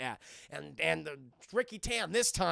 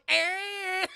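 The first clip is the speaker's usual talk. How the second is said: loud, high sustained "eh"; mock flailing scream; 11 semitones higher, 9 dB louder than usual. Chris Tucker hitting the truck, comic mock scream. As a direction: shriek comically while flailing.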